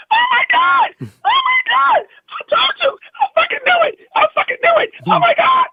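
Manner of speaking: piercingly high mock shriek of joy; imitated ecstatic screaming. Wife hears husband got the show, screaming with excitement. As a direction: shriek like an overjoyed wife, full out.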